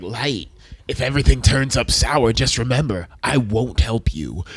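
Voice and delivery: low, ominous death-god voice; low mock-sinister warning; Ryuk. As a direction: imitate a demon warning, slowing at the end. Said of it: "he's like".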